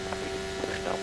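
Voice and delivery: normal talking voice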